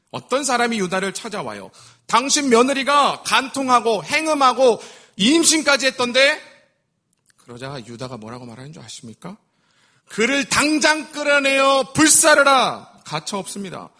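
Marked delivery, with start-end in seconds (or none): 2.1-6.4 raised, louder tattling voice; gossipy exclamation. Acted. Someone reporting scandal to Judah. 10.1-13.0 slow, loud, high raging order; furious command. Acted. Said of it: "극대노를 합니다".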